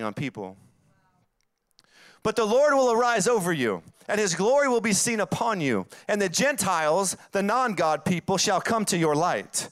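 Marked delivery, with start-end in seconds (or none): 2.2-9.7 scripture reading. Acted. Reads Isaiah aloud.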